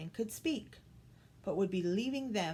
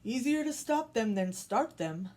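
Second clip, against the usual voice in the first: tiger voice; 3 semitones higher, 5 dB louder than usual. Acted. Tiger speaks dryly.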